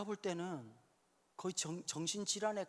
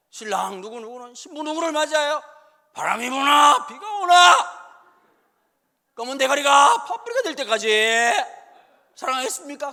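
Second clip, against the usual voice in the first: booming officiant; 8 semitones higher, 19 dB louder than usual. Retold or imitated. Officiant at an old-style wedding bellowing vows to the groom.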